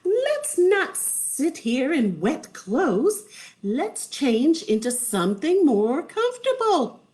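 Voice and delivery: singsong wife; wizard's wife voice. A narrator's reading. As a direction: propose it in a high, lilting voice. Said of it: "until the wizard's wife said".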